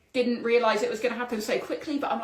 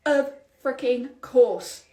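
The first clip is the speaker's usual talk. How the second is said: slow, louder, wry emphasis; drawn-out of freaking course; 2 semitones higher, 5 dB louder, much slower than usual. Real, not acted. Host accepting the lost house, slow wry emphasis. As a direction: stretch it out knowingly.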